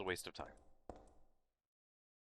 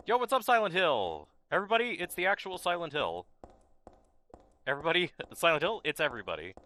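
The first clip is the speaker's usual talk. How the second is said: hyped streamer greeting; 7 semitones higher, 4 dB louder than usual. Pretend. Mock streamer greeting to game.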